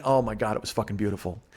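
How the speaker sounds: normal talking voice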